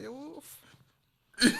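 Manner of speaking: 1 imagined outburst. Retold or imitated. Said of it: "I was like".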